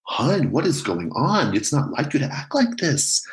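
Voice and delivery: a mother begging her son to calm down; worried mom. Acted. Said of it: "she's like".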